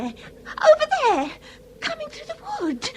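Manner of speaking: fearful cry. Acted. Daughter sees father approaching.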